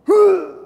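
very high, loud mock huff; exaggerated affronted "huh"; indignant gasp. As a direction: gasp high in hammed-up offence. Acts indignant reply to wife.

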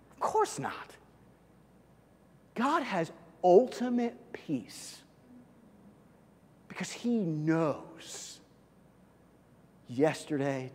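ordinary talk

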